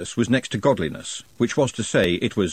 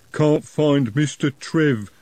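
slow plodding troll; 6 semitones higher, 4 dB louder, much slower than usual. A narrator's reading. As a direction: drag each word, dim troll. Troll speaks.

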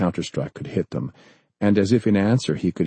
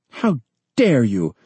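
outraged; 6 semitones higher, 4 dB louder, slower than usual. Acted. Outraged president retorts.

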